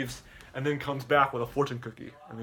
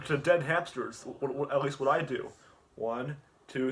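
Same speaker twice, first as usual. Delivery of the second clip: ordinary talk, nothing marked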